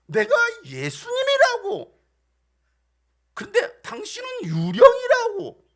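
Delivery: high, loud, drawn-out protest; exasperated insistence. Retold or imitated. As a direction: act out someone desperate to be believed. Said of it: "근데 안 믿는 거예요". (답답해서 높고 크게 우기듯)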